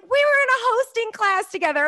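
high and loud, bursting with excitement; excited. Retold or imitated. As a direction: gush it out thrilled. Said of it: "I was like".

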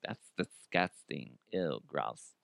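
soft, slow disgusted murmur. Pretend. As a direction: recoil in quiet disgust. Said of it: "Somebody's listening like".

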